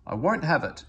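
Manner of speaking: Skarloey objects loudly, refusing to allow it; protesting. A narrator's reading. Old engine shocked at rude words, loud protest. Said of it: "he protested".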